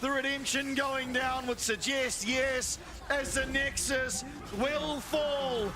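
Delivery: esports caster. Acted. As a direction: scream the victory call, building. Caster calls the winning moment.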